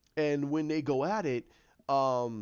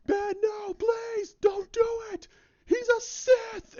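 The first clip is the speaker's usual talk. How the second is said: much higher, loud, straining cry; desperate pleading shout; 5 dB louder, slower than usual. Retold or imitated. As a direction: scream at Ben to stop. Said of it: "He goes".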